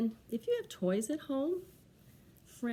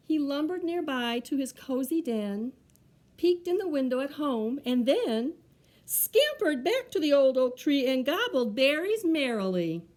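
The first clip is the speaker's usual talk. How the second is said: lively, projected picture-book reading; children's story narration; 5 semitones higher, 9 dB louder than usual. A narrator's reading. Reader tells children how Bear misunderstood, story narration. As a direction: read it out clearly with bouncy rhyme.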